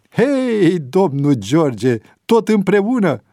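high and loud, lit up; joyful greeting. A narrator's reading. Priest overjoyed to see George again, high and loud. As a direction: greet a dear friend you did not expect.

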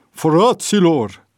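slow, drawn-out loud call; booming call. A narrator's reading. Priest calling out to the crowded cell, loud and drawn out. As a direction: call the room to attention. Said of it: "se auzi o voce de bas".

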